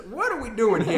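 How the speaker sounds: high loud Southern judge. Acted. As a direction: bellow it like a hammy judge. Mock Southern courtroom bit.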